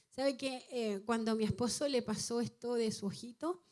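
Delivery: ordinary talk, nothing marked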